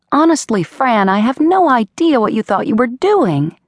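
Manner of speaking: a faraway mother's reproach; distant mother's voice. Acted. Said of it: "My mother's voice was just as distant".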